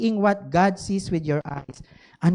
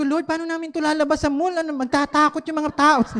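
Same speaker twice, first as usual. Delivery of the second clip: higher, faster, rising panic; worried plea; 8 semitones higher than usual. Retold or imitated. Pastor fretting to the Lord about the mall crowd, higher and rushed.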